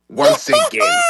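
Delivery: high, mock British broadcaster voice; Augustus impression. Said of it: "am I doing your impression".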